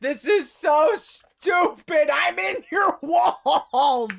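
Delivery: mocking yell. Real, not acted. Player mocking the game's logic, slow stretched yell.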